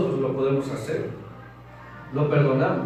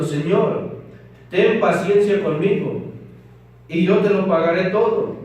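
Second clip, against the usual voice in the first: scripture reading; 4 semitones higher than usual. Acted. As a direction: proclaim the parable to the congregation. Reads the parable aloud. (lectura solemne de la escritura, más alta y fuerte)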